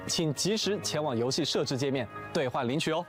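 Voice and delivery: normal talking voice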